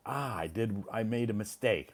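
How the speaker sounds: ordinary talk, nothing marked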